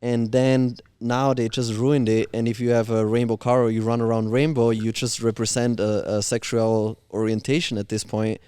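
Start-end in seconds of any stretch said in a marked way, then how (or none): none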